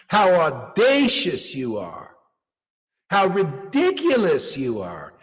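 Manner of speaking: ordinary delivery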